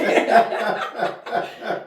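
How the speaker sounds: breathy burst of laughter; loud laugh. Real, not acted. Laughing after daddy quote.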